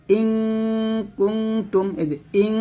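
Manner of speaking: ইং with a light, thin held hum; thin ghunnah. Shown as a demonstration. Teacher showing the thin sound, light held nasal on ইং. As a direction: keep the long "ng" of ইং light. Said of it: "এটা হলো পাতলা".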